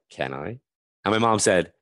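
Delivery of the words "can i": small, low, soft voice; much lower and softer, tentative; retold hushed request. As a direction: ask under your breath, acting it out. Silently asking mother for permission.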